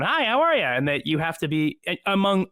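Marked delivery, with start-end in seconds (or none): 0.0-0.7 high, swooping cartoon audition greeting; voiceover audition voice. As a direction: pitch it up like an audition tape hello. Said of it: "it's your voice going".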